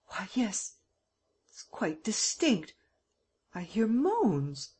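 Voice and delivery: slow, breathy whisper at the keyhole; slow hushed whisper, straining to hear. A narrator's reading. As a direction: whisper as if listening hard.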